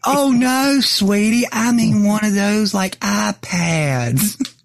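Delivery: put-on high, sweet, drawn-out old lady. Old VA caller reassuring Bo about the iPad, high and sweet. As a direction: coo it like a kindly grandma correcting you. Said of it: "And she goes".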